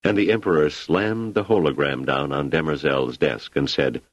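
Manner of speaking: ordinary delivery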